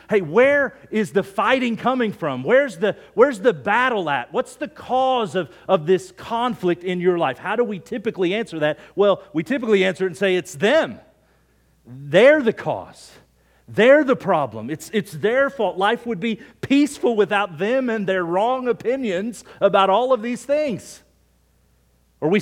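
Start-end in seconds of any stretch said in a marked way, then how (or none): 0.1-7.4 higher, louder acted questioning; mock confrontation, pitched up; acted challenge. Preacher voices James challenging listeners. 10.4-21.0 loud, indignant finger-pointing, hammed up; mock complaint, punching the accusations; acted blaming. Acts out people blaming others.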